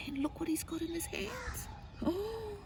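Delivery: high, hushed secretive whisper; excited whisper. A narrator's reading. Reader pointing out what hiding boy holds, whispering.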